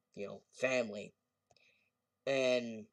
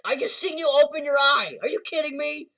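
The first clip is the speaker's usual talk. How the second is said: high shrieking yell of disbelief; outraged disbelief; 13 dB louder than usual. Retold or imitated. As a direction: scream it in shocked outrage. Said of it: "And George opened it".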